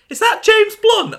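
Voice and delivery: very high, loud astonished ask; surprised question. Acted. Iris asks about the song in the club, very high and loud. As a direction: shriek it in disbelief. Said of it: "Iris asked".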